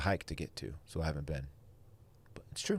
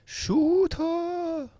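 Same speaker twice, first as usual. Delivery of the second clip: very high, long drawn-out call; echoed catchphrase holler; 4 dB louder than usual. Acted. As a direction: mimic the shout even higher and longer.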